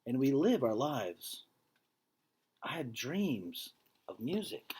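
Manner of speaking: earnest, measured read-aloud voice; story narration. A narrator's reading. Teacher reading a picture book to students, earnest storytelling. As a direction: read the story to the class with feeling.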